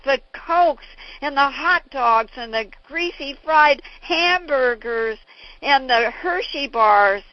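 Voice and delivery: loud, high, slowing on each food; preaching list. Retold or imitated. Father listing junk food to the audience, loud and slow. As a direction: spit out every bad food. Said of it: "He said".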